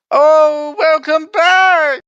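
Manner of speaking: high, loud, drawn-out welcome; effusive hostess greeting. Acted. Game master as the woman greeting returning guests, loud gushing falsetto.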